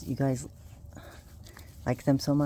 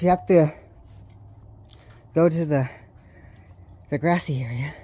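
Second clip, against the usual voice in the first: out of breath after the door; winded; 4 dB louder than usual. Real, not acted. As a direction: talk a bit winded. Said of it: "And I'm winded".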